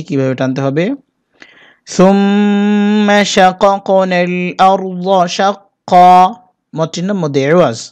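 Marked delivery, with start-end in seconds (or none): 1.9-6.1 slow melodic tajweed chant; slow sung Quran recitation. Shown as a demonstration. Teacher recites Quran verse.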